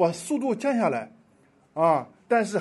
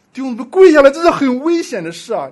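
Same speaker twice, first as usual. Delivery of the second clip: 1 loud exhortation; 6 semitones higher, 14 dB louder than usual. Real, not acted. Preacher exclaims to congregation.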